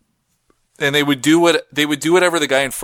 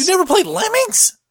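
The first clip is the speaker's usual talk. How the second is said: surprised. Real, not acted. Disbelief friend never played game.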